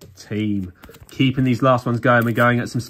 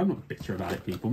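much softer, muttered under the breath; quiet grumble; 5 semitones lower, 10 dB quieter, much faster than usual. Shown as a demonstration. To viewers, he mutters that he isn't bitter. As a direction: mutter it like you don't mean it. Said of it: "Maybe I am just a little bit bitter".

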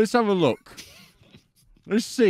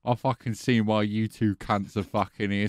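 ordinary talk, nothing marked

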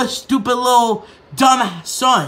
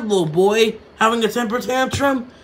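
ordinary talk

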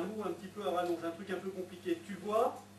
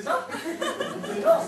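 excited laugh; 9 dB louder, much slower than usual. Real, not acted. Volunteer confirms, crowd laughs.

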